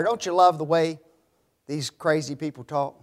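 ordinary delivery

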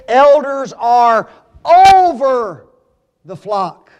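slow build to a drawn-out "over"; stress on OVER. Real, not acted. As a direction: hit "over" hard, then drop away. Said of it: "why are you emphasizing that".

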